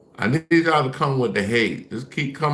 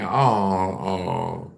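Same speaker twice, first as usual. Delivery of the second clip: normal talking voice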